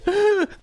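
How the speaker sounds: high, loud imitated laugh; squeaky put-on giggle; Noah's laugh. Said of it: "he always laughs like this".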